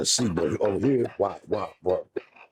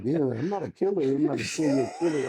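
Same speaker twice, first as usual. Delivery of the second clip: confused fan; 7 semitones higher than usual. Retold or imitated. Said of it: "and they be like".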